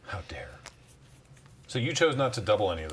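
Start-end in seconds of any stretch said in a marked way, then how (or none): 0.0-0.5 gasping whisper, mock shock. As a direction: gasp then whisper it. Mock outrage at the play.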